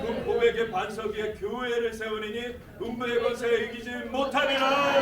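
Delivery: full-force preaching. Real, not acted. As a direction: preach it out to the rafters.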